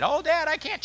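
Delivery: put-on high whining child's complaint; whiny kid. Father mimics his kid whining to him.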